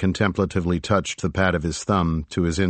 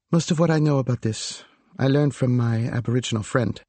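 put-on lightly higher, thoughtful young woman; 6 semitones higher than usual. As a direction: muse it like a curious young woman. Narrator voices Cordelia at length.

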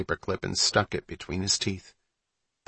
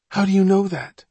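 scared little brother; 10 semitones higher, 4 dB louder than usual. Acted. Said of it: "Malin asked".

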